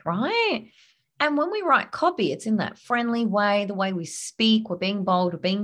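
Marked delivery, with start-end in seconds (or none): none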